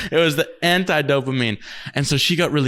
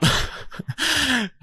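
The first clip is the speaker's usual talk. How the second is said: a sudden high-pitched laugh; high burst of laughter; 12 semitones higher, 3 dB quieter than usual. Real, not acted.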